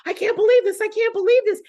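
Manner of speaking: high, loud mock disbelief; imitated amazed excitement. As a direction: gush it like she just won. Retells team member's joy at gold.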